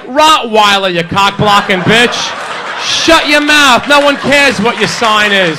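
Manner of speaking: loud, scornful comeback; mock angry put-down. Man to the friend who butts in, loud mock anger. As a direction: shut her down loudly, hammed up. Snaps at the friend asking her sign.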